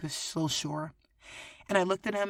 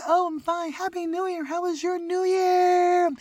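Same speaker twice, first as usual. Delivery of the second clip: tipsy cheer; 11 semitones higher, 8 dB louder than usual. Retold or imitated. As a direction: call out happily, a bit drunk. Tipsy greeting to neighbor.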